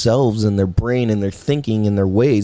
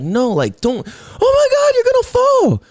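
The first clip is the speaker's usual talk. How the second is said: imitated panicked yell, much higher and louder; mock panic; 4 dB louder than usual. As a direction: shriek like a frightened grown-up. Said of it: "'cause it was like".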